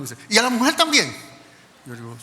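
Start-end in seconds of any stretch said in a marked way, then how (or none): 0.3-1.2 loud, high astonished question; incredulous. Retold or imitated. Guard seeing two passports after barring the wife, incredulous. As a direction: imitate someone who can't believe the news.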